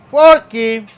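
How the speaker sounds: loud playful call to the fork. Real, not acted. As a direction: shout for the fork like a pet. Calling for his lost fork.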